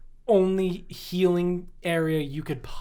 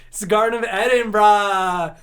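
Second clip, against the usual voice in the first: hammed-up Adam and Eve; 4 semitones higher, 6 dB louder than usual. Comic plays Adam and Eve getting home, hammed-up cry. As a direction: cry it out, stretching the ending to "braaah". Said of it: "would react when they got home".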